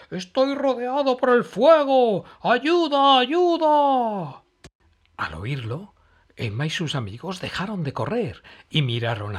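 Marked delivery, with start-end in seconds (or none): 0.1-4.4 very high, loud scared cry for help; frightened shriek. Acted. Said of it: "chilló Matías asustado".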